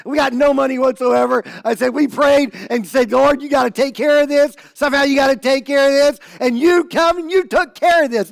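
high, loud, excited retelling; overjoyed outburst. Retold or imitated. Pastor telling Jake their prayer was answered, overjoyed shouting. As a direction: shout it out in high-pitched amazement. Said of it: "I start laughing and I go".

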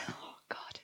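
breathy aside under the breath; whispered to herself while reading a hard name. Real, not acted. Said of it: "it's a hard name".

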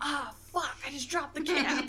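flustered mom. Retold or imitated. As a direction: play mom startled by the dropped cap. Mom drops the cap, swears.

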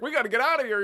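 urgent. Retold or imitated. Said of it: "She's like".

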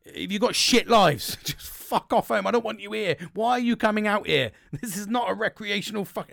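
loud, high, hammed-up exasperation; angry rant, retold. Volunteer cursing at people turning up, hammed-up angry rant. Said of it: "I was getting angry with people turning up".

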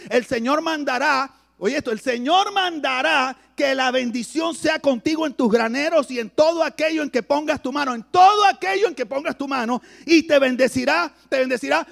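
preaching at full force. Acted. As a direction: preach it out to the whole church.

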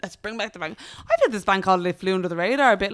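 ordinary talk

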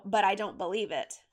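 ordinary delivery, nothing marked

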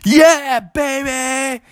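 high-pitched celebratory yell; excited cheer. Acted. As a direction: cheer like thrilled boys. Boys cheer the T-Rex.

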